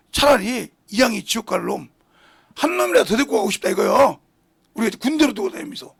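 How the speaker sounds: loud, driving sermon delivery; fiery preaching. Acted. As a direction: preach it out hard to the congregation. Preaching at full force.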